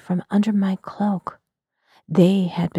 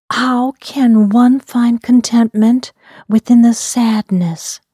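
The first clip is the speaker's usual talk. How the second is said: loud, urgent question; blurted question; 2 semitones higher, 8 dB louder than usual. Acted. Narrator blurting a question to the Kaliak, loud outburst. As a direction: blurt it out much louder. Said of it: "I blurted out".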